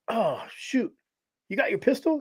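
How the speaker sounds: a high, quiet oh-shoot to his ex-wife; sudden dismay, pitched high and hushed; alarmed realization. Retold or imitated. Man realizing to ex-wife they forgot the pistol, alarmed.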